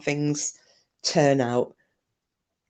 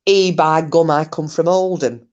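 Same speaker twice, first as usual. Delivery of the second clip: a lightly put-on, poor Oldham accent; mock a Northern Oldham voice, badly; 5 dB louder than usual. Mocking a rival streamer with a poor Oldham accent. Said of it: "a really shit Oldham accent".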